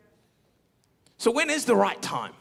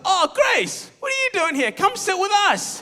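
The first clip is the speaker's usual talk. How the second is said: much higher, loud, overly cheerful greeting; imitating Keenan; 13 semitones higher, 5 dB louder than usual. Friend Keenan waving Grace over to sit: high, loud, cheery imitation. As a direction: call out to her like a too-friendly buddy. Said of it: "And then Keenan's like".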